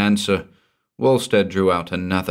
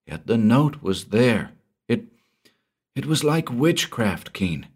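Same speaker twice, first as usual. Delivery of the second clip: much higher, anxious explaining; frightened Walstead; 5 semitones higher than usual. Acted. Frightened man explaining his ruin, anxious and high. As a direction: plead your case in distress.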